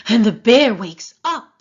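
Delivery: loud, sudden announcement; loud dramatic. A narrator's reading. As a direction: burst out on the wake. Dramatic wake.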